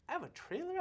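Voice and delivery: high-pitched incredulous question; can't-believe-my-luck astonishment; amazed. Retold or imitated. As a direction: reenact delighted disbelief, pitch leaping up. Acted amazement at getting a trailer.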